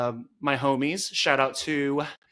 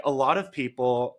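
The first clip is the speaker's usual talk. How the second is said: ordinary talk, nothing marked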